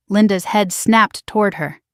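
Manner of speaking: ordinary delivery, nothing marked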